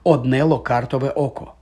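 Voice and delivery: plain reading voice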